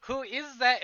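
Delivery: usual speaking voice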